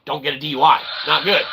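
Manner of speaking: normal talking voice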